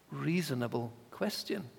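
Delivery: ordinary talk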